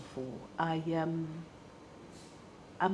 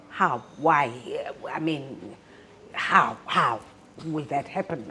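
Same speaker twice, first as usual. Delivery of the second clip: retold outburst; 4 semitones lower, 7 dB louder, slower than usual. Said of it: "And I'm thinking".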